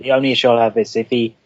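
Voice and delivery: ordinary talk, nothing marked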